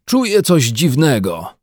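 lively character exclamation; calling out. A narrator's reading. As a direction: announce it out loud to everyone. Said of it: "zawołał".

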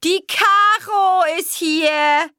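slow, loud, high calling out; loud announcement. A narrator's reading. Mother announcing Caro's arrival, slow loud call. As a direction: call it out so the whole house hears.

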